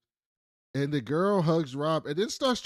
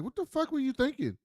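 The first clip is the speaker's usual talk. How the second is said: high, outraged scolding; mock angry boss; furious boss; 8 semitones higher than usual. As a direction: tear into him over the bad call. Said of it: "And Adler's like".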